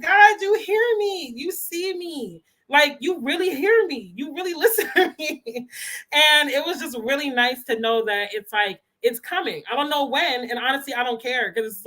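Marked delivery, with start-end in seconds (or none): 0.0-5.7 high, slow, tearful plea to God; emotional prayer. Retold or imitated. Replaying her tearful prayer to God in the car, high and slow. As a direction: pour it out like a breaking prayer. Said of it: "crying again".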